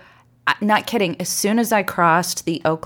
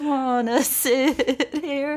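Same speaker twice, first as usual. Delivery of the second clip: dramatic scene; 9 semitones higher, much slower than usual. Acted. Opening clip, dramatic outburst.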